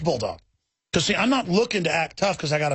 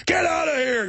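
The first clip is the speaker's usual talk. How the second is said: shooing off dogs; 10 semitones higher than usual. Retold or imitated. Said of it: "and I said".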